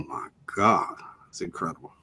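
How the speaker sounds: soft, low, whispered amazement; hushed awe. Real, not acted. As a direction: drop to a near-whisper in wonder. Awed at the beautiful ocean view.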